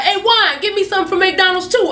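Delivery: high, loud call down the mall. Retold or imitated. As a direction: yell to a friend. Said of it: "yelled down to his homeboy".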